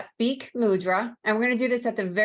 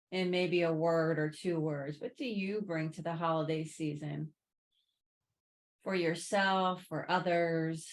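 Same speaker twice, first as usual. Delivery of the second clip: soft, unhurried meditation voice, settling lower; guided reflection; 3 semitones lower, 9 dB quieter than usual. Real, not acted. Yoga teacher prompting resting students to reflect on the holidays, soft guided reflection. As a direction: offer each thought quietly, leaving room between them.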